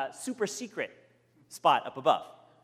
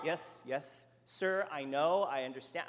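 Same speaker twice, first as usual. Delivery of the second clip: soothing; 4 semitones lower, 8 dB quieter than usual. Retold or imitated. Frank soothing angry man.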